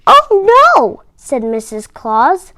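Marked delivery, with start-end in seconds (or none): 0.1-1.0 dismay. A narrator's reading. Mrs Claus dismayed.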